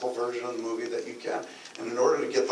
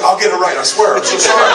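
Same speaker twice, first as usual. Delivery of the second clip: pleading apology; 6 semitones higher, 15 dB louder than usual. Retold or imitated. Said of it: "if you look like you're about to cry".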